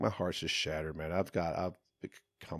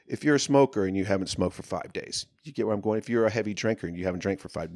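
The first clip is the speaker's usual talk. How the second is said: ordinary talk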